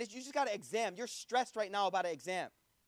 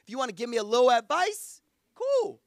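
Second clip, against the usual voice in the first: casual swagger; 7 dB louder than usual. Retold or imitated. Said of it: "I'm kinda like".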